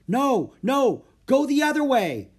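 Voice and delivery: high, loud alarmed shout; crying out. A narrator's reading. Boy sees elephant head for bazaar, alarmed cry. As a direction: cry out to turn someone back. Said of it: "cried Jack".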